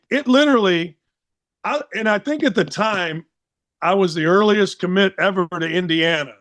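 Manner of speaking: normal talking voice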